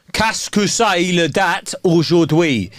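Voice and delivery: French line said slowly. Retold or imitated. Explaining French to a friend, slow careful French. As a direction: spell it out slowly for a foreigner. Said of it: "in French".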